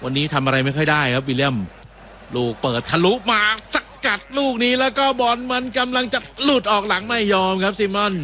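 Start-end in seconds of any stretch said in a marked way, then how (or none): 2.3-8.1 higher-pitched match call as the attack builds; live play-by-play. Real, not acted. Commentator calling a through ball to viewers, raised play-by-play voice.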